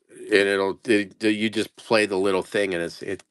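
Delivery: ordinary delivery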